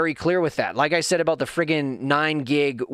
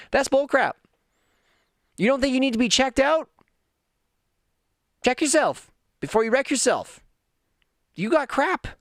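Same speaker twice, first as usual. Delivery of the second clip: calling out; 9 semitones higher than usual. Real, not acted. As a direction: push it hard at the listener. Calling out the listener.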